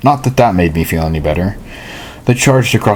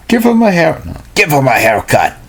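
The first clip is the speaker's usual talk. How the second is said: higher-pitched attempt at Jersey accent; rough Jersey accent; 7 semitones higher than usual. Bully ordering her gang to attack, in a Jersey accent. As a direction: put on an uncertain Jersey accent. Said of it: "if that's a Jersey accent".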